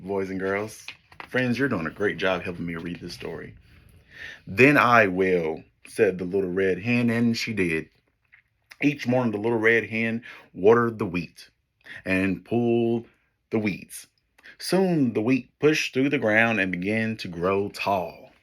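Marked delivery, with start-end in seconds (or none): 4.5-5.6 slow, louder, firm hen voice; determined hen. Acted. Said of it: "Remember, I'm the hen". 5.9-18.3 put-on lively storybook read for kids; story narration. Reads picture book to class.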